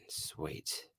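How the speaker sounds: breathy whisper, almost silent; whispered aside. Real, not acted. Streamer muttering to himself, whispered. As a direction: drop to a private whisper. Aside after a repeated question.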